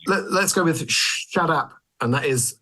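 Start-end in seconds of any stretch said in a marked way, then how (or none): 0.9-1.7 "Shut up" said as "shaddup"; "Shut up" as a punchy "shaddap"; spelt-out slangy pronunciation. Shown as a demonstration. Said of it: "S H A double D U P".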